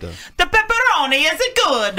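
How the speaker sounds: high, hammed-up Italian accent; ridiculous sing-song Italian accent. Shown as a demonstration. Co-host performing on request, ridiculous Italian accent. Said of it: "I do a really good Italian accent".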